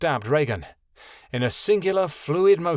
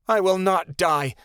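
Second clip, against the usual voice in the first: louder, raised and firm refusal; firm denial; 6 semitones higher, 3 dB louder than usual. A narrator's reading. Wounded girl insisting to the griffin, firm and raised. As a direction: plant your feet, covering doubt. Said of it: "Regan said firmly".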